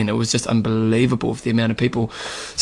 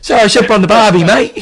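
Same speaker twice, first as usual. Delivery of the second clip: high, louder mock Australian twang; second go at Aussie, pitched up on "mate"; bad Aussie accent; 7 semitones higher, 5 dB louder than usual. Host retrying for his co-host, in an exaggerated Australian accent. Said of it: "I'm not too good at the Aussie one".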